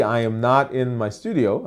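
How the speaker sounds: usual speaking voice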